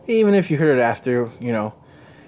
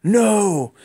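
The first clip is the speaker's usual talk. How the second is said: a higher, louder mock cry of alarm; retold yell; 8 semitones higher, 4 dB louder than usual. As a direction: shout Gwen, no as if she's falling. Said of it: "But Spider-Man yells".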